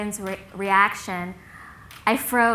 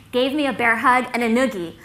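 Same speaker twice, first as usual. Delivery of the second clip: usual speaking voice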